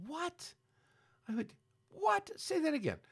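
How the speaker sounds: can't believe his ears, way up high; a real jolt of shock at the news; stunned disbelief. Real, not acted. Shocked at the school banning song.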